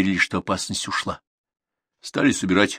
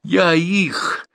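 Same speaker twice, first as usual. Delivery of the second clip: high-pitched, angry outburst; furious threat; 10 semitones higher than usual. A narrator's reading. Grandson rages at those taking his bulls, furiously. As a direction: threaten them, voice jumping up. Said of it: "загорелось бешенство".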